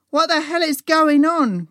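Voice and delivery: loud, high-pitched startled outcry; alarmed cry. A narrator's reading. Sue to her friends as screams echo, alarmed cry. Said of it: "cried Sue".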